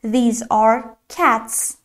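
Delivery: "these are cats" spoken very slowly, word by word; slow model sentence. Shown as a demonstration. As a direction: spell it out slowly for learners. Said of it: "مثلاً".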